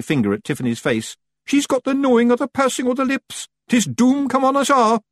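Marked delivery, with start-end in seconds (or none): 1.5-5.0 very high Scots doom cry; high hammed-up alarm, getting louder; panicked shout. A narrator's reading. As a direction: proclaim doom as a terrified wee Scotsman.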